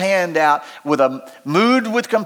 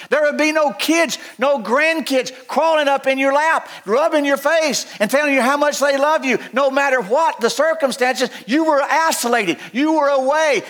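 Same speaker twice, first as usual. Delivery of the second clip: higher, building preaching voice; impassioned preaching; 8 semitones higher than usual. Acted. Preacher describing the leper's isolation with rising fervor. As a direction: press the point with rising fervor.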